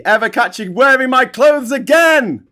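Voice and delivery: high, loud mock-furious yell; hammed-up scolding that builds to a shriek; 怒鳴るオチ. Joke punchline, employer scolds butler.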